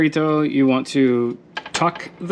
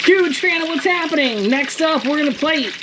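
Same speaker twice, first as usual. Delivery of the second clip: high excited voice; 12 semitones higher than usual. Real, not acted. Excited cook, sudden high voice.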